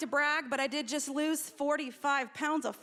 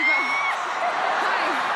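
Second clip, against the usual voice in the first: squealed hi; 14 semitones higher, 7 dB louder than usual. Acted. Greeting judge with fries.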